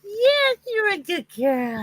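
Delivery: put-on high cooing baby talk to a kitty; pet baby talk. Guest coos at the cat on the video call, high baby talk.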